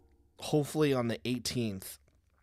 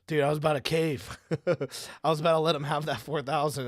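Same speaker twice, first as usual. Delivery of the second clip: higher, louder relieved friend voice; mock relieved friend; 6 semitones higher, 6 dB louder than usual. Friend in the car after the deal, mock relieved. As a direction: ham up the buddy's relief.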